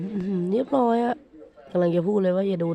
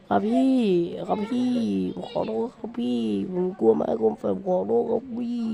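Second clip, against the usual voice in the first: high-pitched, pleading mock apology; playfully cowering, higher voice; mock frightened apology; 3 semitones higher than usual. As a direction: beg forgiveness as if scared, hammed up.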